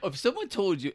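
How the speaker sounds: ordinary delivery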